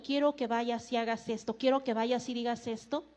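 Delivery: soft, low voice of God; quiet divine instruction. Acted. God sending someone to speak, quiet and low. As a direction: give the orders gently, low and hushed.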